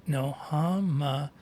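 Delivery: Hamashuach sounded out slowly, ch throaty like in Bach; sounding out Hebrew. Shown as a demonstration. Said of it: "Let me sound it out".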